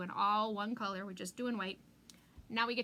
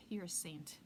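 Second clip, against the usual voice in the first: whisper; 6 semitones lower, 9 dB quieter than usual. Real, not acted. Admiring aside to viewer.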